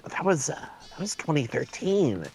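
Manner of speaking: mild impression, working out a memory; Richard Horvitz. Actor recalling the year for his wife, lightly imitated. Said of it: "a really good Richard Horvitz impression".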